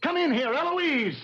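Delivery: high-pitched, irritated calling out; angry summons. Acted. Husband calls his wife in to confront her, angry. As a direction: order them in, drawing out the name. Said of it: "Why are you so angry?".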